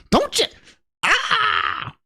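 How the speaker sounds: very high put-on wail; mock outrage. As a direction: cut in with a hammed-up high cry. Mock critic cuts in, outraged.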